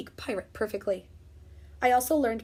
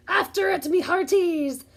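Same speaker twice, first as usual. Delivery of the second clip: high loud pirate order; captain ordering the crew after the ball; pirate command; 7 semitones higher, 7 dB louder than usual. Acted. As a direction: command the chase. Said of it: "Braid Beard commanded".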